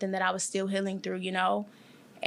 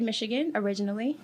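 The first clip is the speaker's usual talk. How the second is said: usual speaking voice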